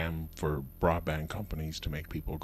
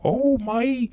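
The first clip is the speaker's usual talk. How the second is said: high, loud, slow rustic customer voice; hammed-up high yokel; 13 semitones higher, 8 dB louder, slower than usual. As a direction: play a clueless country customer, pitched way up. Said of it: "he said".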